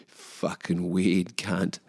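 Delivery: slow low sneer; muttered contempt. Acted. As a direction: spit it out slowly, quietly. Mock rant at husband.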